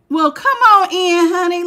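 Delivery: high, loud, slow welcome; delighted welcome. Real, not acted. Greeting a viewer joining the live reading, high and loud.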